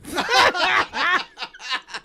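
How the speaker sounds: squealing laughter; high-pitched laugh. Real, not acted. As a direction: break into a high shrieking laugh. Laughing fit.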